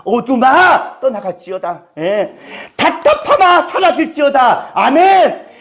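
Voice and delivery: rousing declaration with punched phrase endings; preaching at full force. Real, not acted. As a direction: proclaim it to the congregation, driving each phrase.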